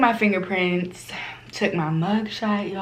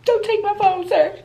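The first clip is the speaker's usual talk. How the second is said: high, pleading little voice; pleading with the officer in a high puppy-dog voice; 13 semitones higher than usual. Retold or imitated. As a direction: beg like a sad puppy. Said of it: "make the little puppy dog cheers".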